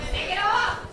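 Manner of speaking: high, sudden call to the crowd. Real, not acted. Comic shouting to the audience, high call-out. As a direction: toss it out to the room.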